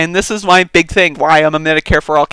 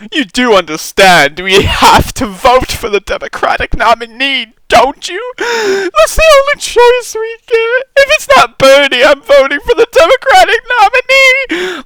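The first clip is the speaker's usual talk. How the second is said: mock liberal voice; 10 semitones higher, 4 dB louder than usual. Mocking a commenter lecturing him on voting, high and smug.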